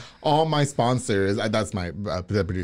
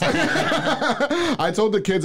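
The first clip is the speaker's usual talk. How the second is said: laughing; 11 semitones higher, much slower than usual. Real, not acted. Eating tacos, laughing about sharing.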